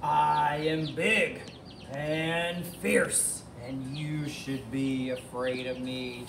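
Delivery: slow, drawn-out monster voice; menacing dinosaur. Acted. Tyrannosaurus tells Edna to be afraid, slow menacing voice. Dinosaur boasts to frighten chicken.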